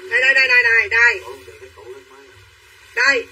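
loud, insistent calls of here, here; loud calling out. Real, not acted. Seller calling livestream buyers to look, loud insistent calls. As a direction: call out loudly to grab the viewers.

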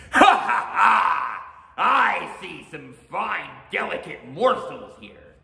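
booming ogre laugh, slow and high; loud, drawn-out gloating with swooping pitch. A narrator's reading. Oni eyeing the ladies as prey, gloating loudly.

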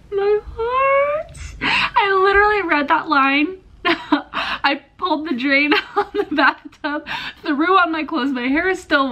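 high, breathless gushing with a laugh; overwhelmed squeal. Real, not acted. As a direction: swoon over the line, voice up high. Overwhelmed by romantic line.

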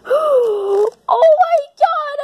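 high, stretched-out squeal; thrilled. Acted. Character seeing the kitty cat, thrilled. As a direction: draw it out in delight.

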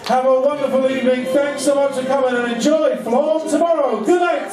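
loud, high stage announcer voice; big cheerful closing announcement; show announcer. Acted. Loud show host sign-off.

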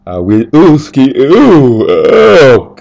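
high, loud startled ooh sounds out of nowhere; a surprise squeal that escapes mid-sentence; sudden yelp. Real, not acted. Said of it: "that just came out of nowhere".

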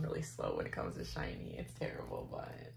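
whispered admission; confessional whisper. Real, not acted. Confessing being slow.

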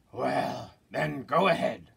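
troll voice. Acted. Troll letting the second goat pass, loud grudging voice. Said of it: "said the troll".

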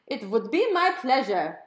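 high, loud, hammed-up German accent; an overeager German butler voice; German computer squeal. A narrator's reading. Home computer answering Mom, squealing in a German accent. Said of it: "the computer squealed".

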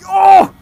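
very loud, high "oh!"; startled yell. Real, not acted. Rider jolted mid-ride, startled high yell. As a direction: yelp out at full volume. Jolted while riding the bike.

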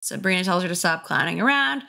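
ordinary talk, nothing marked

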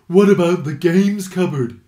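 Rolf the Badger. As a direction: call up from under the pile. Rolf speaks from underneath.

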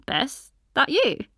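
lightly voiced friend checking who it is; friend on phone. A narrator's reading. Ishal answering Bess's call, surprised.

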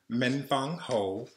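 plain reading voice

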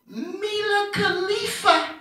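put-on high, slow, sing-song baby voice; baby's sing-song first word. Daughter's first words, high slow sing-song baby voice. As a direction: sing it out like a toddler.

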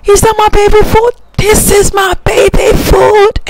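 put-on very high, squealing baby voice; excited baby voice. Mother speaking as her baby, very high, excited squeal. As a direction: squeal like a thrilled baby over food.

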